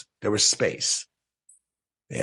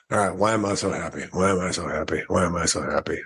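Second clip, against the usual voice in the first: low, unenthusiastic mumbled repetition; half-hearted; 5 semitones lower, faster than usual. Retold or imitated. Man alone in his truck trying the mantra, half-hearted. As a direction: recite it by rote, pitched low, not meaning it. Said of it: "kinda like that".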